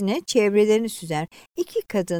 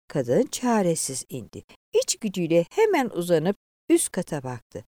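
usual speaking voice

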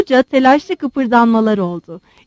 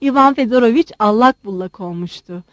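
ordinary delivery, nothing marked

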